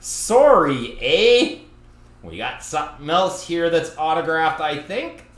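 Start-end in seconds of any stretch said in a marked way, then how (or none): 0.0-1.6 Canadian sorry eh. Acted. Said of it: "Nothing more Canadian than on Canada Day saying".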